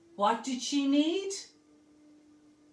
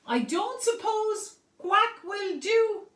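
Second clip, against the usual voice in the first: duck asking; 7 semitones higher, 3 dB louder than usual. Acted. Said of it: "asked the duck".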